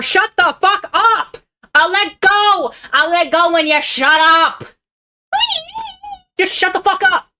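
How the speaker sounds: high-pitched, drawn-out mock yelling; mock strangling rant. Acting out strangling an irritating woman, mock enraged yell. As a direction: shout it as if throttling someone in play.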